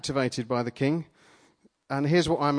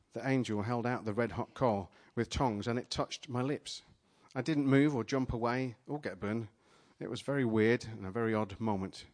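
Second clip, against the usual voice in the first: ordinary talk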